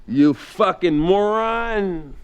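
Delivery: slow, drawn-out loud sneer; Daryl's insult. Retold or imitated. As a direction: spit the insult out slow and heavy. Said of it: "he could say this like nobody else".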